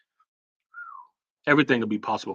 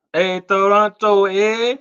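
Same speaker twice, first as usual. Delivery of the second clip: hammed-up Canadian accent, higher and louder, slow; 8 semitones higher, 8 dB louder, much slower than usual. Shouting out Toronto viewers on the stream in a playful mock Canadian accent. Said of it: "this is how I learned how, uh, Canadian people talk".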